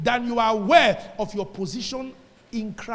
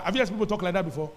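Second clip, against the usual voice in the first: ordinary delivery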